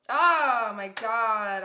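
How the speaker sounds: loud, drawn-out emotional cry; overwhelmed, near tears. Real, not acted. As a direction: let a slow, loud "oh my God" burst out. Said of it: "I'm literally gonna cry".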